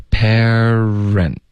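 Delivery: "parent" slowly, with a long pursed-lip r; rounded-lip R. Shown as a demonstration. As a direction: stretch the r in "parent" with lips pushed out. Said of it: "嘴唇要撅起来".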